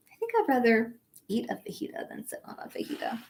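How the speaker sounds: quick unvoiced joke; whispered aside. Real, not acted. Teacher whispers her own joke about fajitas to the class. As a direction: whisper it behind your hand.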